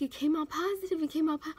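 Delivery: mild, high-pitched thrilled cry; excited retold outburst. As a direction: re-enact the shock of great news, pitch lifted. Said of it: "I started screaming and I started crying".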